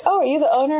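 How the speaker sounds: bright-pitched, lightly acted question; curious customer. Customer to the gym's owner, mock curiosity.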